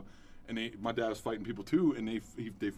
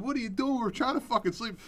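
neighbor lady; 10 semitones higher than usual. Retold or imitated. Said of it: "It's like a lady from across the street".

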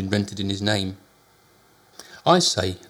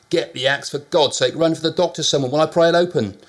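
frantic urgent orders; 6 semitones higher, 5 dB louder than usual. A narrator's reading. Urgent demand repeated.

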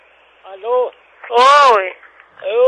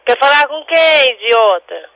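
higher question falling into an insult; impatient, end on a dismissive insult; real annoyance; 4 semitones higher, 7 dB louder than usual. Real, not acted. Insults the evasive caller.

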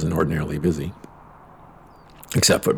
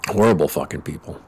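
usual speaking voice

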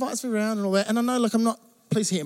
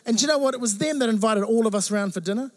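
ordinary talk

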